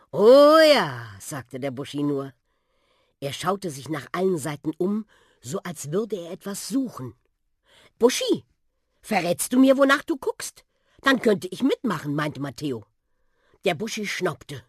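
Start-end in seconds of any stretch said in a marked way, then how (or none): 0.1-1.1 put-on eager old bus. A narrator's reading. 8.0-12.1 put-on curious boy. A narrator's reading. Boy Matteo asks the bus.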